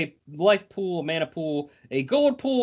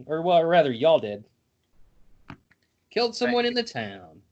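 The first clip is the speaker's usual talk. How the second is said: ordinary delivery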